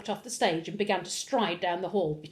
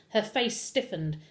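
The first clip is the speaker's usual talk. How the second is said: usual speaking voice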